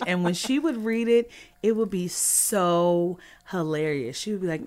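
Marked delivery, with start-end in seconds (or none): none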